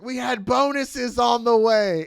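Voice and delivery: loud, high, drawn-out protest; hammed-up aggrieved shout; mock outraged wail. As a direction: yell it like a cheated worker. Retold angry worker's protest.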